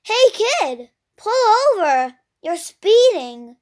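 lightly voiced shouting police officer; officer's yell. A narrator's reading. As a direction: call out an order to pull over. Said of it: "rolled down the window and yelled".

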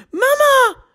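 put-on high child's call of mama, first try; a small girl calls her mum. A narrator's reading. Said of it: "roept Anna".

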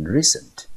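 recent said REcent, stress on the first syllable; teacher recapping how recent is read; model pronunciation. Shown as a demonstration. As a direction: recent with weight on RE. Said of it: "这个字我们就念成".

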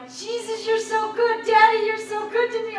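high, half-sung gushing praise; overjoyed praise, almost singing; excited praise. Retold or imitated. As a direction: sing out your delight to Jesus, high and bright. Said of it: "I'm like".

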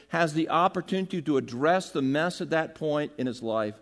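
normal talking voice

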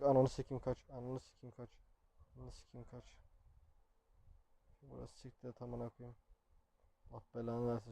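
swearing under the breath while fleeing; very quiet, tense muttering; hushed panicked cursing. Real, not acted. Fleeing from Granny in game. (kısık sesle panik içinde küfür)

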